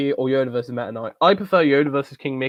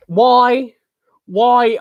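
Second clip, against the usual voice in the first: incredulous why; 9 semitones higher, 7 dB louder than usual. Real, not acted. Asked to do an accent.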